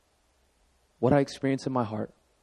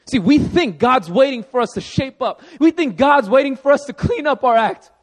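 much higher, louder pulpit drive; preaching at full force; 11 semitones higher, 7 dB louder than usual. Acted. Pastor driving home the point, full-force preaching. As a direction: preach it hard to the congregation.

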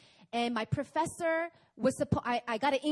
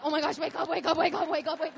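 half-laughing, panicky pleading to wake up; laughing, playful panic; mock panic; 6 semitones higher, 4 dB louder than usual. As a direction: beg yourself to wake from the nightmare. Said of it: "And I'm like".

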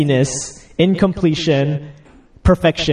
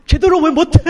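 high-pitched, fast tearful wail; mock crying; 9 semitones higher, much faster than usual. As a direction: imitate a distraught guy whining through tears. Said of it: "He's, like, crying".

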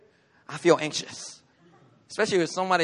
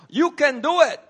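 mock pep talk; 8 semitones higher, 5 dB louder than usual. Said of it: "my message today is not".

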